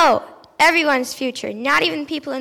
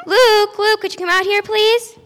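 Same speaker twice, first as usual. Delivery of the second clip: loud, higher call to someone offstage; 7 semitones higher, 8 dB louder than usual. Acted. John calling Luke out, loud and high. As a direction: call him out from the back room.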